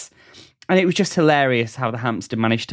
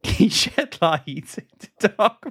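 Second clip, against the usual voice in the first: high, slow, breaking into laughter; laughing through it; 7 semitones higher than usual. Real, not acted. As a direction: try to read it but crack up. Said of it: "you're doing a bad job".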